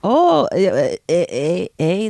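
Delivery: playful Canadian eh, tried out over and over; mock Canadian, toying with eh. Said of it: "They say "eh" a lot in Canada".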